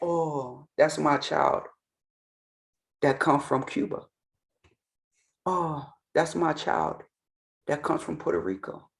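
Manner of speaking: lightly voiced goddess, gentle and knowing; knowing divine mother. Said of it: "if Yemaya is called".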